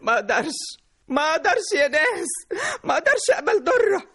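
despairing, tearful refusal; sobbing protest. Acted. Mother sobs she cannot bear it.

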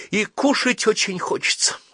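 lightly voiced wistful hunger; regretful. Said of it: "посожалел Калик". (с сожалением, выше)

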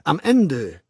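emphatic public lecturer; lecturing World Controller. Acted. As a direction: hold forth with authority, punching key words. Mustafa Mond doziert. (dozierend, nachdrücklich)

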